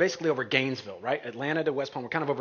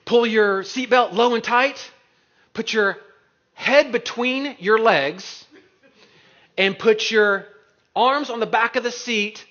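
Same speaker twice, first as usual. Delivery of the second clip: imitating a loud, higher announcer giving crash instructions; emergency cabin announcement; 5 semitones higher, 5 dB louder than usual. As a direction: call out each safety order firmly over the speaker. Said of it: "the, uh, speaker saying".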